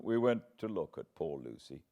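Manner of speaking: ordinary delivery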